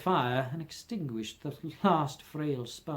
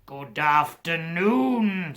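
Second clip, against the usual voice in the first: slow, louder final goodbye; drawn-out dismissal; 4 dB louder, much slower than usual. Acted. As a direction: drag it out to end the talk. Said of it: "said Scrooge".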